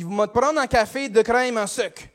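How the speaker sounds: broad Québécois café order; Québécois accent. Acted. Customer orders coffee from the cashier, Québécois accent. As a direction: order as a Québécois regular would. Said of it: "je vais faire un accent".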